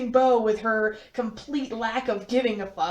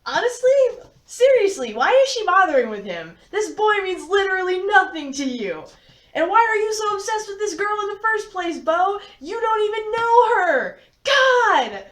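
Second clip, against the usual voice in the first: high, loud frustrated ranting at the book; exasperated rant; 8 semitones higher, 4 dB louder than usual. Real, not acted. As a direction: vent at the characters, building to a shout. Reader rants at the book.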